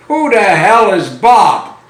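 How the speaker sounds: loud, mock-indignant demand; indignant punchline. Boyfriend to girlfriend after seeing her tattoo, indignant.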